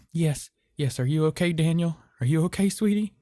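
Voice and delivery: high, shaky, tender comfort; tender worry. A narrator's reading. Miss Lewis comforting the injured boy, high tender worry. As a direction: soothe the hurt child.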